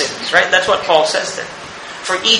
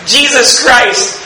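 higher, louder and slow, hammering each phrase; slow emphatic preaching; 6 semitones higher, 3 dB louder, slower than usual. Acted. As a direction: drive the exchange home from the pulpit. Preaching climax, slow and loud.

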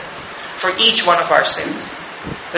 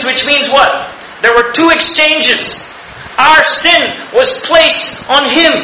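higher, louder and slow, hammering each phrase; slow emphatic preaching; 6 semitones higher, 3 dB louder, slower than usual. Acted. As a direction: drive the exchange home from the pulpit. Preaching climax, slow and loud.

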